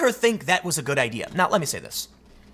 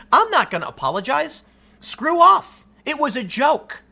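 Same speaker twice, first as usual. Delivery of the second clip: imagined defiance, higher with a loud "Screw off"; defiant refusal; 8 semitones higher, 3 dB louder than usual. Pretend. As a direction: push back hard, spit out "Screw off". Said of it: "You could have said in twenty fifteen".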